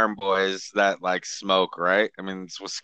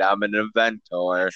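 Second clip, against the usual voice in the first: ordinary talk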